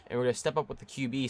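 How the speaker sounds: normal talking voice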